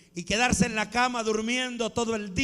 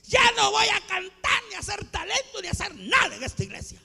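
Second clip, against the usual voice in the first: despairing protest; 9 semitones higher than usual. Acted. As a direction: cry out as if fed up with serving. Acts out despairing complaint to God. (queja desesperada, fingida, a gritos)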